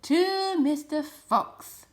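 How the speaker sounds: slow, loud, high cheer; crowd shouting. A narrator's reading. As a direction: shout it like a room of guests. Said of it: "they all shouted".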